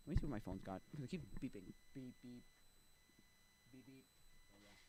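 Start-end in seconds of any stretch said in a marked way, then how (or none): 1.9-4.1 very soft little beeps; quiet, idle beep-beep; imitated phone beeps. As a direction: mimic a beeping phone under your breath.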